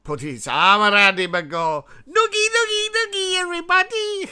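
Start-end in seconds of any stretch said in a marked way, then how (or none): 2.1-4.3 very high, goofy teasing voice; playful high-pitched taunt while rubbing a head; silly high Putin voice. Pretend. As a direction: squeal it like a gleeful bully giving noogies. Putin voiced giving noogie.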